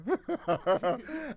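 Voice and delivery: loud, high giddy laugh; delighted laugh. Real, not acted.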